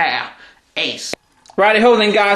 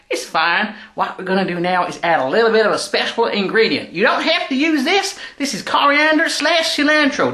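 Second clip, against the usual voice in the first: put-on dodgy Texan accent. Texan tennis player hosting his cooking show, hammed-up Texan accent. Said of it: "let's get back into character".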